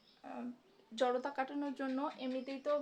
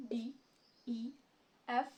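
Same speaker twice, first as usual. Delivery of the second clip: ordinary talk, nothing marked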